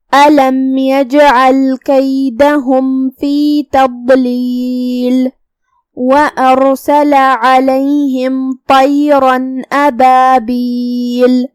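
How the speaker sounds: slow, measured tajweed chanting; slow melodic Quran recitation. Shown as a demonstration. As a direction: draw out each vowel, melodic recitation. Teacher recites Quran verses.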